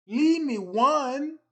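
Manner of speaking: loud drawn-out complaint; pleading shout. Real, not acted. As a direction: yell at Josh to save one. Begging Josh over honey buns.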